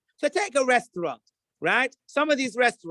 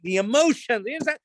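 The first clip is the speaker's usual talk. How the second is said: ordinary talk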